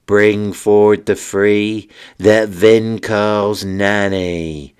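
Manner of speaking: slow, drawn-out incantation; summoning spell. Acted. Wizard summons three good witches, slow incantation. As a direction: intone each line, stretching the last words.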